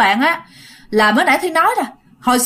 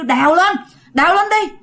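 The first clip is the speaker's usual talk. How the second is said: commanding; 6 semitones higher than usual. Retold or imitated. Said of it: "kêu".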